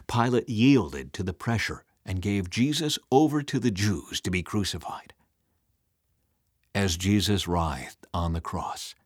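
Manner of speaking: plain reading voice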